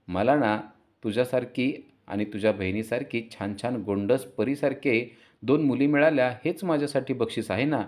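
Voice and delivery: plain reading voice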